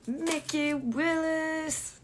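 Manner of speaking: long, drawling American "Willis"; American accent. Shown as a demonstration. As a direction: stretch the name out in an exaggerated American accent. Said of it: "je dis avec l'accent américain".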